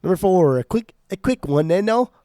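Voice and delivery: hammed-up English accent, pushed loud; mock English accent. Co-host announces album pick number four in a mock English accent. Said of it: "That was my English accent".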